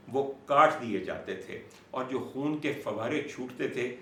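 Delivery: ordinary delivery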